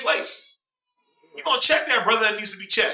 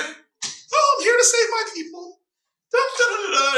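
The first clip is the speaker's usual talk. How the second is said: much higher, prissy sing-song mockery; mocking a skipping, tiptoeing God, voice pitched way up; mock dainty savior; 12 semitones higher than usual. Mocks a prancing dainty God.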